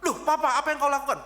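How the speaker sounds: high, loud startled cry; a bound son shocked as his father seizes him; alarmed cry. Retold or imitated.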